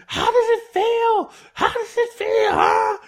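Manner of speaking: high-pitched, strained taunt; mock taunting yell. Wrestler beating up Red Shoes' son, mock taunting yell. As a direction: imitate a villain jeering at his victim.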